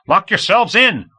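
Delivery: high, loud and slow angry yell; shouting straight into the microphone; irritated shout. A narrator's reading. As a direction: bark the order in exasperation. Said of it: "Komov shouted with irritation".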